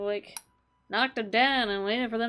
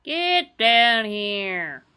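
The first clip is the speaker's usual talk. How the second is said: loud, slow yell at the enemy; drawn-out yell; 8 dB louder, much slower than usual. Real, not acted. Gamer yelling at a flipping enemy, loud drawn-out call.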